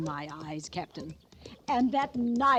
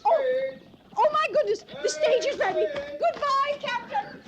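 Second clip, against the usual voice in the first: much higher, louder, all aflutter; flustered fluttering; 4 dB louder than usual. Acted. Mrs Feemster rushes to her stage, flustered and high. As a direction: fluster and rush off.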